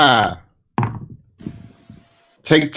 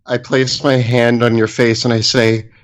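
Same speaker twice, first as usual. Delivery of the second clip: ordinary talk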